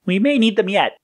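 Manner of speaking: high-pitched sidekick voice; light, high voice for Yellow; Yellow's character voice. A narrator's reading. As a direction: speak high, as the cautious partner. Yellow's voice in the story.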